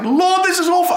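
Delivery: high, wailing cry to God; howling lament. Acted. Sufferer howling to God in pain, wailing. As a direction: howl it out in raw distress. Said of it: "with howling, with crying, with sort of snorting".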